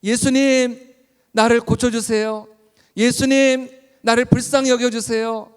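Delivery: high, very loud, drawn-out cry; full-voiced beseeching shout. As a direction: shout desperately to be heard over the crowd. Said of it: "더 큰 소리로 주님께".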